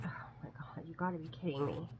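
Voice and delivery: hushed aside to a friend; acted quiet, exasperated mutter; whispered disbelief. Acted aside between the two friends.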